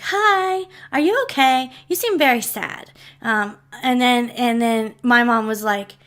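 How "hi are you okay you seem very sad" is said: put-on higher-pitched child's voice; lightly voiced small girl, higher on the greeting; little girl. Little girl checking on a sad stranger in the cemetery, childlike. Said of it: "a little girl walked up to her and was like".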